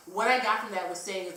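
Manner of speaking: ordinary talk, nothing marked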